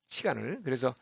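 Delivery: ordinary delivery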